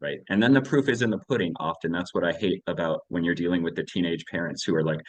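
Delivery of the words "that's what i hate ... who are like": ordinary talk, nothing marked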